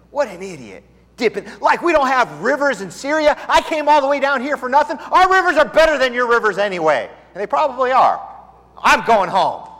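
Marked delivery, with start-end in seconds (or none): 0.1-7.1 loud, high mock fury over the rivers; angry rant. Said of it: "stomped around and said". 8.8-9.7 huffy exit. Retold or imitated. Naaman storms off angrily.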